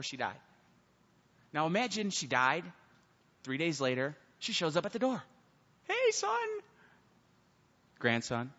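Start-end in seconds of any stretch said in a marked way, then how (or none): 5.8-6.5 put-on high, wavering old granny voice; quivering old woman voice. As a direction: play a frail grandmother back from the dead. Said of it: "with her old, like, quivering voice".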